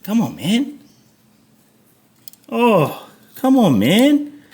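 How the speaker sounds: high, stretched pleading whine; exasperated plea. Real, not acted. Begging the stuck terminal to go on, exasperated whine. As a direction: beg the part to cooperate, slowly.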